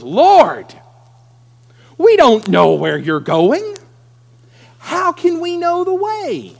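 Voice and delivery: loud panicked plea, climbing high at the end; frantic outburst. Acted. Thomas panicking at Jesus leaving, loud frantic plea. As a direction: cry out in alarm. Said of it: "Now Thomas goes nuts".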